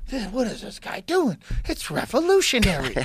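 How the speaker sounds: high, put-on old Hollywood voice; old movie guy voice. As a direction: gawk like a 1940s moviegoer. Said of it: "everybody's like".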